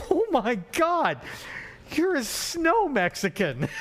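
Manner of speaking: high, drawn-out teasing outburst; hammed-up horrified discovery, slow and high; mock shock. Teasing a friend who is Canadian, mock shocked.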